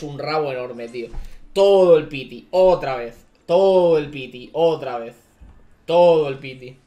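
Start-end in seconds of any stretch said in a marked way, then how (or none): 1.5-6.8 loud frustrated repeated outburst; louder furious complaint; angry rant. Real, not acted. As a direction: vent it loudly over and over. Ranting, pity again.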